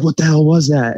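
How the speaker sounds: retold alarmed question. Husband acting out wife after the scream, alarmed and high. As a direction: act out a rattled wife's question.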